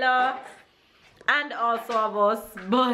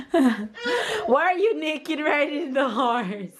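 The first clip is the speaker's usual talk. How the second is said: laughing through the question; giggling while asking, slowed down; 5 semitones higher, slower than usual. Real, not acted. Mother laughing at naked toddler.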